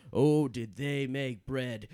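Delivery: ordinary talk, nothing marked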